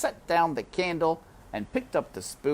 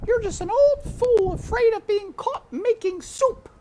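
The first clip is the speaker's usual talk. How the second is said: cook scolding herself; 9 dB louder than usual. A narrator's reading. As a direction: chide yourself in the cook's high voice. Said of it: "she said to herself".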